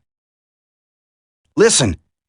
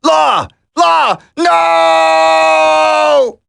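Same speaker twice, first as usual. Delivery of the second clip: anguished shout; 4 semitones higher, much slower than usual. Acted. Soldier crying out as his comrade is left behind. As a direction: scream his name as he is lost.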